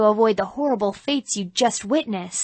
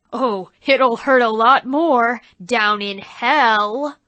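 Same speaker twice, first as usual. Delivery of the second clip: slow, louder sinister stage devil; menacing devil; 5 dB louder, slower than usual. Acted. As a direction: gloat over the sinner. Devil-horned actor menaces.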